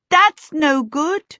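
high, loud, slow dismissal; exasperated. A narrator's reading. Charmaine rejects useless instructions in an emergency, exasperated. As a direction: reject it emphatically, drawn out. Urgent reply amid bathroom emergency.

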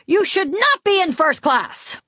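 high-pitched, indignant dressing-down; scolding. A narrator's reading. Rich passenger to woman he resents, scolding. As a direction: tell her off in outrage. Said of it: "he was preparing to scold me".

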